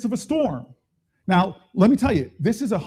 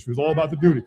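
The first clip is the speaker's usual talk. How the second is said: ordinary delivery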